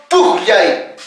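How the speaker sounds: "pujyay" slowly, with the J sounded mid-word; pronunciation demo. Shown as a demonstration. Teacher shows the class the middle J, slow pronunciation demo. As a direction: stretch "pujyay" to expose its middle J. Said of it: "cuando digo".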